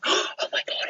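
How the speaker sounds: gasped, whispery fan excitement; starstruck teenagers. Retold or imitated. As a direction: imitate awed fans gasping under their breath. Imitating teenagers spotting a celebrity.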